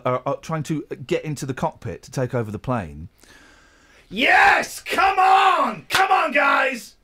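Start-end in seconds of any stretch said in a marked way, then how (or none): 4.1-6.9 high, loud cheering shout; crowd cheering. Retold or imitated. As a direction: yell like a cinema crowd rooting. Acting out cinema crowd cheering.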